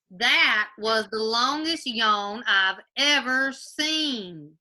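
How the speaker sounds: drawn out very slowly; slow reading. Acted. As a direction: stretch every word out. Teacher reads the yawn sentence.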